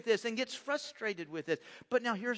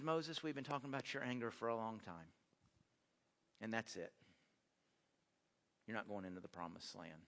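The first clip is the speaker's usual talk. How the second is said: much lower and softer, heavy and final; grave verdict; 11 semitones lower, 12 dB quieter than usual. Retold or imitated. God pronouncing Moses' punishment, low and grave. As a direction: let each word drop low and quiet.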